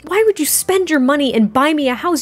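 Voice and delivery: high-pitched, mildly indignant protest; a little bit angry. Acted. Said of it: "She's a little bit angry".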